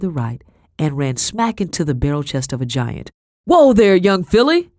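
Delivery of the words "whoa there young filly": big, loud booming call. Acted. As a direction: boom it out like a giant. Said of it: "a deep voice boomed".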